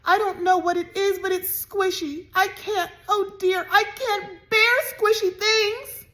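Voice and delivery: high, loud tearful wail, slowing at the end; sobbing. A narrator's reading. Annabelle to her aunt, still sobbing. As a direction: sob through every phrase. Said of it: "Annabelle was still sobbing".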